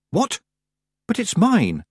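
put-on high, indignant girl's objection; startled protest. A narrator's reading. Tiffany is told to give up her necklace, startled protest. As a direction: protest at a pitch well above your own.

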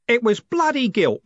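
gruff, loud coachman's growl. A narrator's reading. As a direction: grumble it angrily. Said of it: "he growled".

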